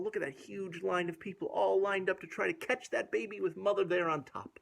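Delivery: ordinary delivery, nothing marked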